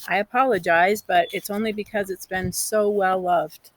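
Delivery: plain reading voice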